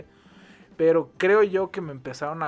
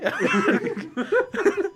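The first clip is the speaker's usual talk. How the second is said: high laughter burst; 4 dB louder than usual. Real, not acted. Laughs after dad bit.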